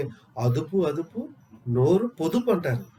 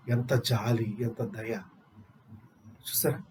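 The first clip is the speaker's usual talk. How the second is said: hushed tender awe; 8 semitones lower, 7 dB quieter, slower than usual. Acted. Marvelling at the Samaritan's compassion.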